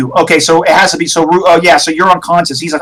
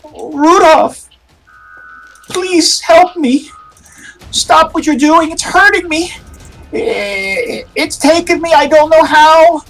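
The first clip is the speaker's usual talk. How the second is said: high, pained, begging woman's voice; pleading ex-girlfriend; 11 semitones higher than usual. Acted. Ex-girlfriend begging Rudolph to stop chanting, high and pained. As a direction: beg him to stop, voice high and hurting. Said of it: "She's crying and she's pleading with you".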